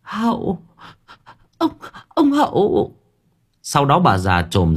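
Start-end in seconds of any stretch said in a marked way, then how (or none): 0.0-2.9 very high, strained cry; old woman waking in terror, cry much higher; dying cry. A narrator's reading. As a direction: call out like someone about to die. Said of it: "kêu lên như người sắp chết".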